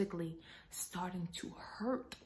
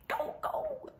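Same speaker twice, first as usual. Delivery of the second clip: very high-pitched urging; imitated urgent partner. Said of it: "my partner was like".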